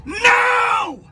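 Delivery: loud sharp voice. Real, not acted. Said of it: "You have no right to scream at me".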